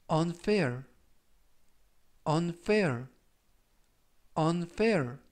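unfair said clearly, three times; teacher modelling unfair; model pronunciation. Shown as a demonstration. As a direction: repeat unfair carefully for learners. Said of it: "이라고 발음하시면 되겠고요".

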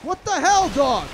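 high, loud outraged yell at the dog; sudden furious shout at a dog; startled shout. Real, not acted. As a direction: shout at the dog as it jumps you. Dog attacks him suddenly.

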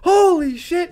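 loud, high-pitched awestruck yell; imitated awe. Character learns the mouth guard is real, imitated awe. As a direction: belt it out in acted amazement.

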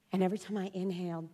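normal talking voice

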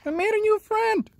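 high, delighted little announcement; pleased, high-pitched. Real, not acted. Man who just befriended a cat, high delighted voice.

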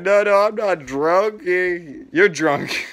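hammed-up drunk whine, words stretched; drunken denial. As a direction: act plastered while insisting you're sober. Playing drunk, denying it to Grandma.

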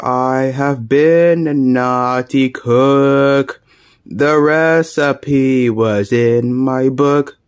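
deep, drawn-out villain chef; a low, slow menacing chef. Acted. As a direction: speak slow and low like a sinister cook. Chef character speaks slowly.

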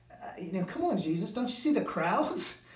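a cynical friend scoffing at the obvious; cynical disciples. Retold or imitated. Disciples scoffing at Jesus, mock incredulous. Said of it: "They're a little cynical".

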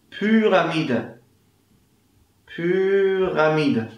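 "Pyramide" said slowly with a long Ü; slow model word. Shown as a demonstration. Teacher repeating "Pyramide" slowly for learners.

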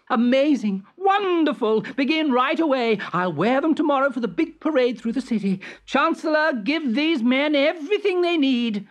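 high, loud, delighted royal orders; a vain king overjoyed; thrilled emperor. Acted.

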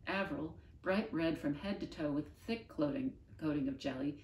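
plain reading voice

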